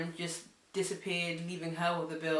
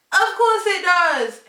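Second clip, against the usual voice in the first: high, loud, drawn-out protest; outraged retort; 13 semitones higher, 13 dB louder, slower than usual. Retold or imitated. Arguing with drunk friend that age matters, outraged. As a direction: belt it out in disbelief.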